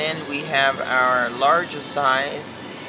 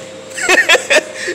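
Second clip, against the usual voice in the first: real high-pitched, loud laugh; 7 dB louder than usual. Real, not acted. Laughing with shop staff over an unclean market, high laugh.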